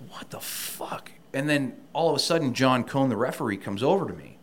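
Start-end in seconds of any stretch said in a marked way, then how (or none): 0.1-1.1 breathy, slow, voiceless what the fuck; stunned whisper. Retold or imitated. Recalling the wrestler's rude reply, a slow stunned whisper. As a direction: mouth it slowly in disbelief.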